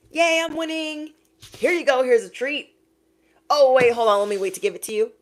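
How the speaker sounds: high, loud taunting singsong; mock smug teasing. Mocking a smug person who dangles a treat.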